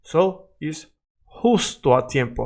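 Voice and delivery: normal talking voice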